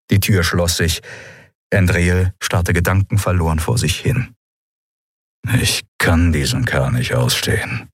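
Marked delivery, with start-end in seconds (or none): 5.6-7.9 low growl of dislike. A narrator's reading. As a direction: grumble it deep in your voice. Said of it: "knurrte Thelios".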